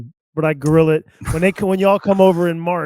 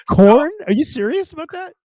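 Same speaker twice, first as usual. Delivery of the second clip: shocked disbelief; 12 semitones higher than usual. Real, not acted. Host reacts to corn not being a vegetable with high, shocked disbelief. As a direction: react as if you can't believe it.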